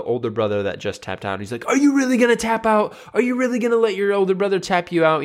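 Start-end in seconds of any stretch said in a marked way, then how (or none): 1.7-5.2 high-pitched, incredulous dad challenge; imitated dad needling his son, voice pushed up; tough love. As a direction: goad him like a disbelieving dad. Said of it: "just some tough love".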